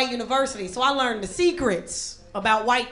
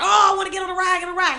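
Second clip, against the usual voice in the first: put-on high-pitched child begging for a ride; excited little kid; 6 semitones higher, faster than usual. Little cousin spots a roller coaster, imitated excitement. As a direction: imitate a kid all worked up. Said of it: "He got all excited".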